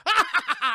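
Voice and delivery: loud, high mock cackle; hammed-up pirate captain belly laugh; Barbossa's villain laugh. Captain sets the crew laughing, loud mock cackle. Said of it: "Barbossa's like".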